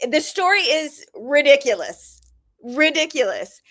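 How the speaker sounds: RIDICULOUS repeated, high with a falling end; emphatic disbelief. Real, not acted. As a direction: marvel at how "ridiculous" it is. Exclaiming over an absurd story.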